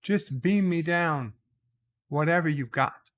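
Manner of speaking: mock-exasperated order, drawn into chunks; Captain Kirk. Captain ordering his ship to beam things down, mock exasperated. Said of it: "And it's like".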